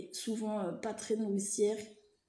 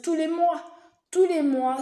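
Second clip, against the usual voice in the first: emphatic; 4 semitones higher, 7 dB louder than usual. Real, not acted. Emphatic repeated correction.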